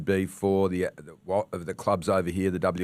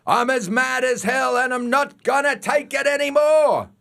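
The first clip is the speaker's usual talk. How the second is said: high shouted movie rant; mad as hell yell; 13 semitones higher, 4 dB louder than usual. Pretend. Host shows listeners what to yell, shouting. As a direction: yell it out the window. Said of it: "poke your head out and yell out".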